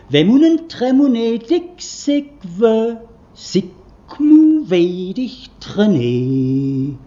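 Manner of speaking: slow, loud, drawn-out syllables; sounding out words. Shown as a demonstration. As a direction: intone each nonsense syllable slowly and loudly. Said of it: "Und das klingt dann so". (langsam und laut deklamierte Lautpoesie)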